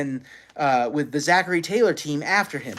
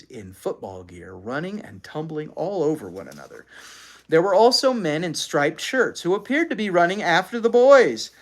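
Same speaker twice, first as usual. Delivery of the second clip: usual speaking voice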